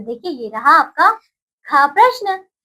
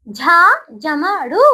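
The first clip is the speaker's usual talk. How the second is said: slow syllable spelling; much slower than usual. Shown as a demonstration. Teacher to children spelling out a word, slow and deliberate. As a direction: sound out झा, जमा, रू very slowly.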